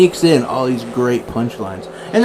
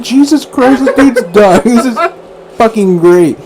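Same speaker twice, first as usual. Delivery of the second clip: higher and louder through laughter; laughing disbelief; 9 semitones higher, 5 dB louder than usual. Retold or imitated. Host recalls her own reaction to the laughing man, laughing higher and louder. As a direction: crack up while you say it. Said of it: "I'm like".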